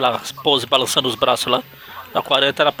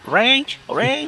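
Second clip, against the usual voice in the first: much higher calling out; nagging old landlady; 12 semitones higher than usual. Acted. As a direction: call up the stairs like a nagging landlady. Mrs Mungus calling, high rise.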